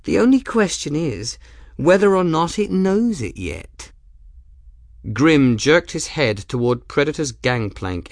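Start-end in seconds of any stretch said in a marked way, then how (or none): 0.0-3.9 high, light, teasing lady's voice; merry tone. A narrator's reading. Ransom teasing Grim about ownership, high and merry. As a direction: toss it off merrily. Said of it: "in a merry tone".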